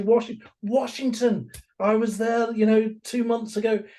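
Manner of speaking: voicing the chatty stranger, pitched high. Stranger from Washington answers the New Yorker, high and chatty. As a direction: play the friendly American tourist, lifted.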